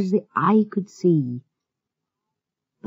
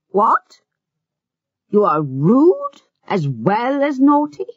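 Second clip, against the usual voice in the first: higher, sharp outraged scold; angry scolding; 7 semitones higher, 3 dB louder than usual. Acted. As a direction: scold the child at the table, indignant. Governess scolds Heidi at table.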